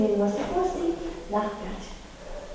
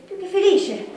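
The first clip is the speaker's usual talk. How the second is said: laughing; 7 semitones higher, 4 dB louder than usual. Acted. Lawyer laughs at her own claim.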